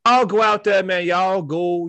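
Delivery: loud, high hype call; hype shout. Real, not acted. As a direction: pump up the listeners. Shout-out to listeners.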